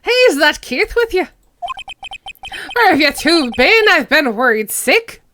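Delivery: loud, fretting Irish character; Irish accent. Acted. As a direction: scold them in an Irish accent. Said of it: "I'll make him Irish".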